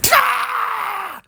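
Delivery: shrieking "Ah! Garfield!" at the cat; shout. Acted. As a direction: scream the cat's name like a furious cartoon owner. Comic character yells at the cat.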